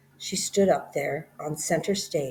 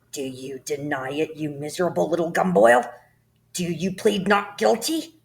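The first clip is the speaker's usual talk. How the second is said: rising, louder accusation; bullying headmistress; 3 semitones higher, 6 dB louder than usual. Acted. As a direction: corner the boy like a judge. Headmistress interrogates the boy.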